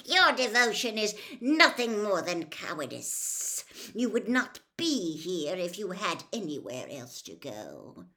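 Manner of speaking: loud, deliberate villain voice; cold angry berating. Acted. Cold master berates his servant.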